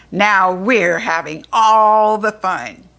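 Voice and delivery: slow, loud gloating goblin voice. Acted. Goblin jeering at the fairies, slow and loud. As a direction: drag it out like a cackling goblin.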